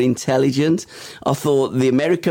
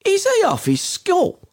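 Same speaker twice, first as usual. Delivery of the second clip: high, slow put-on voice; lightly voiced baffled bloke; incredulous bloke voice. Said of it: "this bloke's going".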